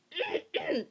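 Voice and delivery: very high squeaky whimper; high-pitched overwhelmed squeak; mock near-crying noise. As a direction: let out a strangled high squeal, as if about to cry. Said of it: "I was gonna start crying".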